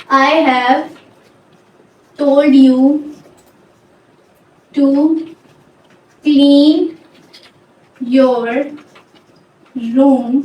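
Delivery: word by word with long pauses; isolated words, drawn out; slow dictation. Shown as a demonstration. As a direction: dictate it slowly, firmly voiced. Dictating example while writing it out.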